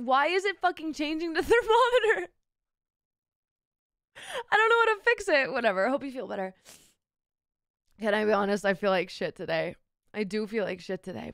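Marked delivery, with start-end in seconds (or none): 0.0-5.5 high, fast frustrated whine; exasperated at the screen. Real, not acted.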